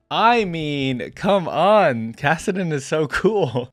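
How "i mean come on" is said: usual speaking voice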